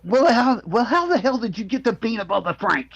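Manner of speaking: high-pitched, hammed-up disbelief; exasperated high film-quote outburst; incredulous movie quote. Quoting comedy film scene.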